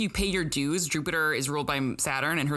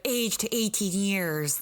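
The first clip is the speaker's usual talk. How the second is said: slow, higher drawn-out Wisconsin take; Wisconsin accent; 5 semitones higher, slower than usual. Retold or imitated. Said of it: "does she say it like".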